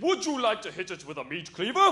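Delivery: hammed-up southern English dad. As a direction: pitch it like a delighted English father. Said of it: "the most southern English man".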